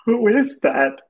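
high, little Smurf voice; startled Smurf. Acted. Smurf spots a stranger in the distance, startled.

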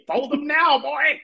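high-pitched, urgent order; urgent command. Acted. Mister Lapels ordering little Katie before launch, urgent.

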